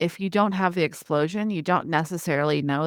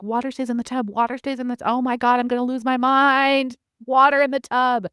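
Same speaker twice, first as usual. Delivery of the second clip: higher, building to a loud exasperated outburst; losing patience; 6 semitones higher, 4 dB louder than usual. Acted. Parent whose kid keeps splashing in the bath, exasperation building. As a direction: escalate from repeated reminder to frazzled parent at wits' end. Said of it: "If I let it go".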